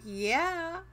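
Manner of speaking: high mock-boastful gamer voice; cocky brag. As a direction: strut like you're the champ. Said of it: "you're like".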